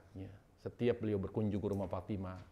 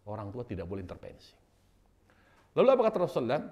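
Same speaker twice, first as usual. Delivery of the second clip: ordinary talk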